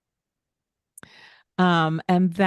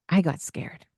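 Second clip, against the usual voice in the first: usual speaking voice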